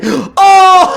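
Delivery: sudden high, loud gasp of surprise; a loud high yelp of joy; excited cry. Real, not acted. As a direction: caught off guard by a great card. Spots full art card.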